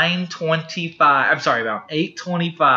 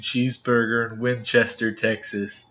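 hyped bro voice; much slower than usual. Retold or imitated. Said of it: "and I'm like".

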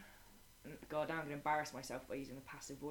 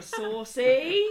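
teasing; 7 semitones higher, 16 dB louder than usual. Retold or imitated. Teacher teasing student about joke.

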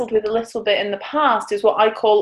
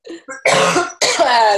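loud hacking cough; choking cough; 4 semitones higher, 5 dB louder than usual. Real, not acted. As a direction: cough hard to clear the throat. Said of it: "Don't choke".

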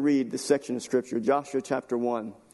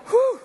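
high, loud whew; big steadying whew after tears; heavy exhale; 6 dB louder than usual. Real, not acted. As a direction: blow out a breath to regain composure. Collecting themselves after crying.

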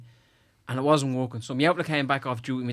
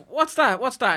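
mum's voice, pitched up and alarmed; high startled mum; 9 semitones higher, slower than usual. Retold or imitated. Son retelling his mum's alarm at the printout, high and alarmed. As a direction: ask it like a suspicious mum.

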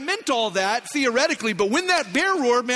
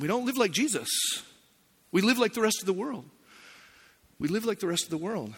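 hushed, much softer confession; sober admission; 7 dB quieter than usual. Real, not acted. Sober turn after contrasting church agreement.

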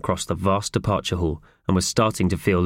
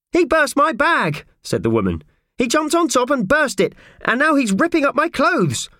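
very high-pitched outraged woman; indignant complaint; 3 dB louder than usual. Acted. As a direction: tell on the culprit in a high, outraged wail.

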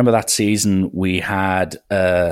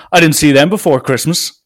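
louder, higher mock accusation; raised-voice challenge, retold; 6 semitones higher, 8 dB louder than usual. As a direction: push it out loudly as an imitated accusing coach. Coach challenges rival over players.

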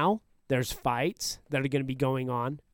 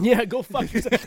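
laughing, loud and higher; 8 semitones higher, 6 dB louder than usual. Real, not acted. Laughing at the joke.

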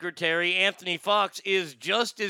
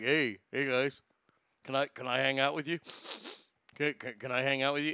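lightly voiced timid dog, low and soft; Bubba the friendly dog; 4 semitones lower, 5 dB quieter than usual. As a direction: softly ask to tag along, lightly in character. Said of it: "Bubba will be like".

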